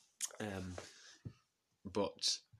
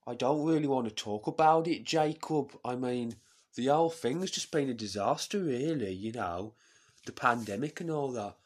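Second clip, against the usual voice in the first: loud, higher put-on voice; exasperated woman MP, pitched up and forceful; Jess Phillips impression; 4 semitones higher, 9 dB louder than usual. MP complaining to Jacob about the pandemic, loud exasperated put-on voice. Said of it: "She says".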